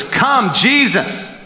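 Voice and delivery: high, pleading cry of Come, Jesus; a father pulling on Jesus, pleading high; begging plea. Retold or imitated. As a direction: beg like a desperate father. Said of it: "It's begging Jesus".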